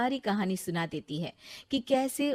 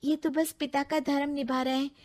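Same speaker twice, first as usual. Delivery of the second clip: higher, earnest pleading; pleading young wife; 6 semitones higher than usual. Acted. Ritu pleading with her mother-in-law, earnest. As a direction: defend the sage gently.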